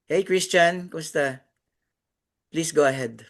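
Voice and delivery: usual speaking voice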